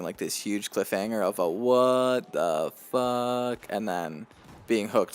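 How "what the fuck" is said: drawn out, very slow what the fuck; stunned reaction. Retold or imitated. Imitating a viewer at the cliffhanger, slow stunned drawl. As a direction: stretch it out, stunned.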